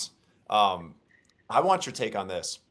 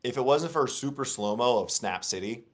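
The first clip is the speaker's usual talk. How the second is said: ordinary talk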